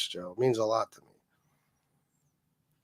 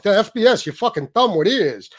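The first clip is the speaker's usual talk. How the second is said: a lightly voiced rival, a bit higher and louder; mock his insults in a hint of his voice; imitating BK; 5 semitones higher, 4 dB louder than usual. Mocking how the rival talked on video, light imitation. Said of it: "He sounded like this when he talked".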